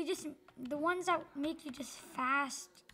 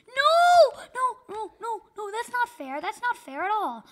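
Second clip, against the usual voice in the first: high loud cries of protest; upset shout; 8 semitones higher, 14 dB louder than usual. Real, not acted. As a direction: yell no as you lose. Losing the game.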